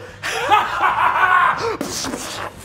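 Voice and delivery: high, drawn-out cackle; long, gleeful pirate guffaw; villainous pirate laugh. Acted. Pirate captain firing back at the devils, cackling.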